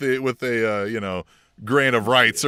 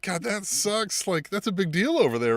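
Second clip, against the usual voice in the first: higher, very slow sympathetic groan; mock sympathy; 7 semitones higher than usual. Cohost imitating Wheeler, slow high sympathetic groan. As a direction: drag it out in mock pity. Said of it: "He's like".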